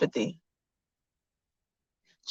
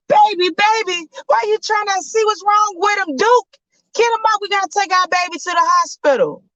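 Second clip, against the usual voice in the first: frantic mother. Acted. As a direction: urge your husband to move now. Mother urging husband to hospital.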